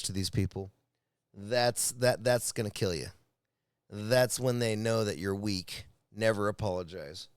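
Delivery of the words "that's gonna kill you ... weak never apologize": normal talking voice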